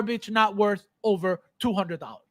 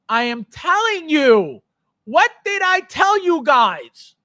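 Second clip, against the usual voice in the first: emphatic rant; 5 semitones higher, 5 dB louder, slower than usual. Real, not acted. Ranting at viewers.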